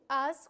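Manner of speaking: "has" loud, with a firm stressed a and silent h. Shown as a demonstration. Teacher showing the future ending for tú, loud stressed a in "has". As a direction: punch the vowel in "has". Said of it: "con acento".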